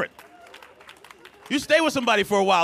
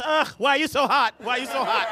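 put-on complaining girlfriend; 5 semitones higher than usual. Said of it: "She goes".

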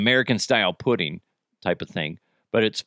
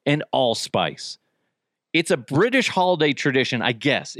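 ordinary delivery

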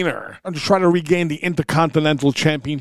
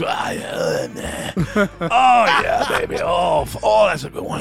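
shit moans; 2 semitones higher, slower than usual. Retold or imitated. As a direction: moan with each drop like a satisfied man. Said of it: "a little fucking audible sounds".

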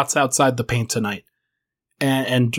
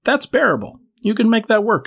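ordinary talk

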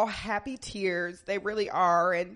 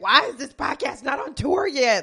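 mock impatient; 6 semitones higher, 7 dB louder than usual. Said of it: "So I'm kind of like".

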